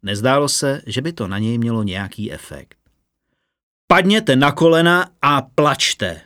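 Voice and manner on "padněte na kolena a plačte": slow, louder, solemn proclamation; a prisoner commanding the crowd to kneel, loud and slow; calm commanding prisoner. A narrator's reading. Prisoner Vahargem addresses the crowd, slow and loud command. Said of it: "pokračoval nevzrušeně Vahargem".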